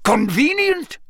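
high, sharp incredulous question; indignant echo. A narrator's reading. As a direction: toss the word back in disbelief. Karkaroff echoes sharply.

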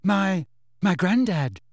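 put-on mildly nervous boy's voice; hesitant pupil. A narrator's reading. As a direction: answer haltingly, unsure of yourself. Nervous pupil answering the inspector.

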